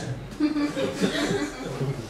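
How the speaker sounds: high strained mock growl of a furious cashier; the cashier growls seeing him come with pennies. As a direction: growl at him through your teeth. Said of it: "and she growled".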